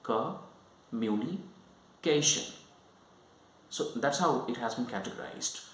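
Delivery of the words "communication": "Communication" very slowly, pausing between chunks; syllable by syllable. Shown as a demonstration. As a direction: split "Communication" into slow, separate syllable groups. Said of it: "this word categorized in three syllables".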